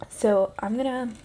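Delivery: slow, loud build-up. Real, not acted. Dramatic drawn-out preface.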